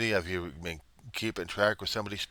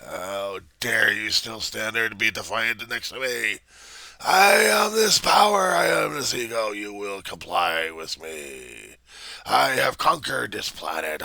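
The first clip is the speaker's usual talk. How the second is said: ranting bully; 3 semitones higher, 6 dB louder, slower than usual. Acted. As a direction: roar like you rule the planet. Bully rants louder.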